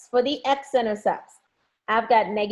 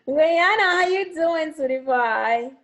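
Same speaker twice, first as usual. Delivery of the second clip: slow, higher sing-song hello; affectionate greeting; 6 semitones higher, 3 dB louder, much slower than usual. Real, not acted.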